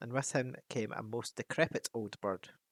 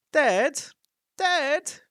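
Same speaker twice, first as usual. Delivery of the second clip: loud, high disbelieving shout; shouting dwarves; 10 dB louder than usual. Acted. Dwarves shout in disbelief at the news. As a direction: shout it in astonishment. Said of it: "shouted the dwarves".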